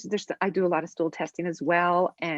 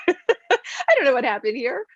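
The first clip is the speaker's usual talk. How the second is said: high, louder, through a laugh; laughing bewilderment; 6 semitones higher, 5 dB louder than usual. Retold or imitated. Practitioner tells front office person about it, laughing bewilderment. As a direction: laugh it off in baffled disbelief.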